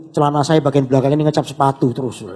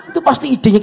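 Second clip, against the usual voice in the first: ordinary delivery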